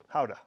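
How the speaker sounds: Gouda as "HOW-ta"; Dutch pronunciation. Shown as a demonstration. Correcting himself before tasting, Gouda said the Dutch way. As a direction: open Gouda on an H, not a hard G. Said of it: "as the Dutch would say".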